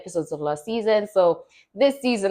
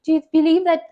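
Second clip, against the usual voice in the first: higher, earnest questioning voice; earnest church leader; 10 semitones higher, 3 dB louder than usual. Retold or imitated. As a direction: imitate the church leader pressing gently.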